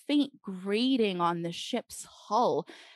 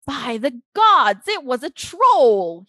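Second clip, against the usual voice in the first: startled shout, much louder; loud cry; 5 semitones higher, 12 dB louder than usual. A narrator's reading. The king seeing the troll unmasked, loud startled cry. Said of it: "he cried".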